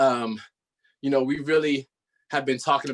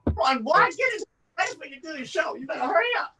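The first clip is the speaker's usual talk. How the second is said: put-on higher, fast granny hurrying the boy; impatient grandma; 8 semitones higher, much faster than usual. Grandma rushing the boy to the show, higher and hurried. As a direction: nag him along like his grandma. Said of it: "this is Paperboy's grandmother".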